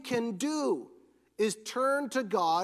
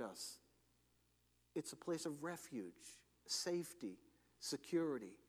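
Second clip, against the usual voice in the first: very soft, lowered voice; hushed reassurance; 4 semitones lower, 8 dB quieter than usual. Real, not acted. Preacher urging listeners toward a safe place, hushed and calm. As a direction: drop almost to a murmur, leaving long pauses.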